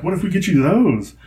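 high, sing-song bribing offer; enticing. Retold or imitated. Imitating parents bribing child with souvenir, enticing. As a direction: dangle the treat before a kid.

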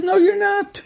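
very high, insistent angry tirade; outraged officer arguing. Retold or imitated. Officer berating SS men to free the captives, high-pitched outrage. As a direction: order them to stop, pitch far up, pressing on. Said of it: "they had a whole fight".

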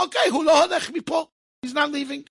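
put-on the old rabbi putting his foot down; stern rabbi. Rav Shach insists boy stays.

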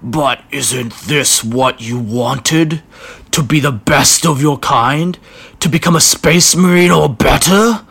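slow raspy voice through gritted teeth; raspy strong voice. Acted. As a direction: rasp it out, rising in force. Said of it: "uttered through gritted teeth in a raspy but strong tone".

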